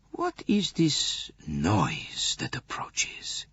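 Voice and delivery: hushed alarm. A narrator's reading. Poirot hears the noise approach, in an alarmed whisper. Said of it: "he cried".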